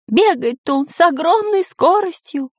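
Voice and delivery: high-pitched alarm; alarmed cry held up high; Пыхтелкин crying out. A narrator's reading. Professor warns of the dinosaur's speed, crying out. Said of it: "кричал профессор Пыхтелкин".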